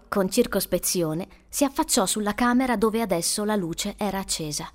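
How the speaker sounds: ordinary delivery, nothing marked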